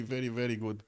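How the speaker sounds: usual speaking voice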